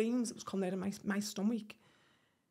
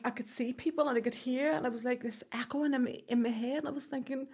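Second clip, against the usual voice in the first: ordinary delivery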